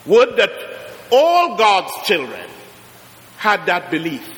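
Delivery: ordinary talk, nothing marked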